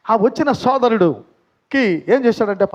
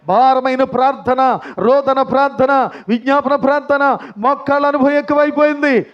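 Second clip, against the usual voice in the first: raised, full-force preaching voice; fervent preaching; 6 semitones higher, 4 dB louder than usual. Acted. Preacher stirring the congregation with fervent, raised voice. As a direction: preach it out with fire to the church. Preacher telling of praying woman.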